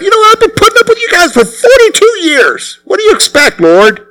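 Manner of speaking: high, put-upon mock protest; high falsetto mock exasperation; exasperated Moses. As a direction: whine back at the Lord as fed-up Moses. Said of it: "Moses could have said that".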